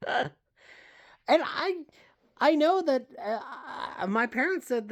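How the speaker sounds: squeaky high voice. Real, not acted.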